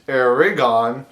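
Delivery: "Aragon" with a full "gone" ending; the wrong "Aragon", punched in three beats; mispronounced state name. Retold or imitated. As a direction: mimic outsiders saying "Aragon" with emphasis. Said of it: "a lot of people think it's like".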